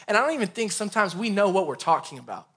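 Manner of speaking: normal talking voice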